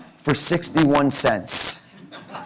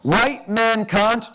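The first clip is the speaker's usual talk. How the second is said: slow, drawn-out repeat; slow emphatic echo; 5 semitones higher, much slower than usual. Acted. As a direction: stretch each word out. Host echoes the phrase slowly.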